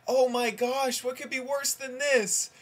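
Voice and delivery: high, loud cry of dismay; hammed-up high exclamation of horror; mock horror. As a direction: play an aghast mother at full pitch. Mother's climax of horror in joke.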